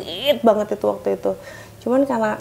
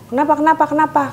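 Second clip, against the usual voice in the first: sharp raised challenge; angry demand; 5 semitones higher, 4 dB louder, faster than usual. Retold or imitated. Husband confronting the technician, angry. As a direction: snap the question at the stranger.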